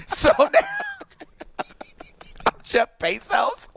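ordinary delivery

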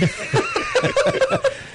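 very high peal of laughter; real laughter. Real, not acted. Host joking about Rusty bleeding, high-pitched laughter. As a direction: crack up helplessly.

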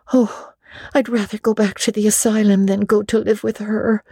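high, pleading and passionate; passionately. Acted. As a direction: protest with all your heart. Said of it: "said Anne passionately".

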